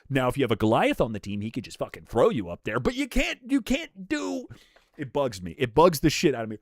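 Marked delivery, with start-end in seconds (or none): 2.8-4.5 exasperated. Real, not acted. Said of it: "Now I gotta fucking rant".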